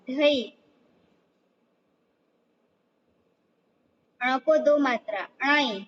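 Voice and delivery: steady sing-song reciting of each letter with its vowel sign; slow classroom chant, one syllable at a time; letter drill recitation. Shown as a demonstration. Teacher drilling young pupils on the Gujarati vowel sign.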